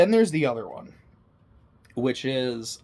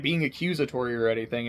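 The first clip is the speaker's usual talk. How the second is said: ordinary delivery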